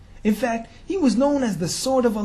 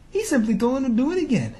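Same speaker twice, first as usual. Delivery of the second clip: ordinary talk, nothing marked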